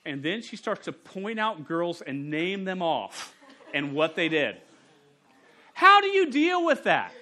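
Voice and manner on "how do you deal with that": much higher and louder, disbelieving; a preacher exclaiming at the absurdity; incredulous outburst. Real, not acted. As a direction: throw the question out in exasperated disbelief.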